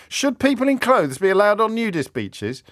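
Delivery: high-pitched, exasperated question; incredulous question. Real, not acted. Presenter scorning his own phone-in question, incredulous. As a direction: ask it as if it's absurd, pitched high.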